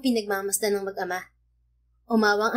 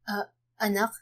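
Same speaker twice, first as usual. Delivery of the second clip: choked up; 5 dB quieter than usual. A narrator's reading. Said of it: "hindi na napigil umiyak ni Angelo".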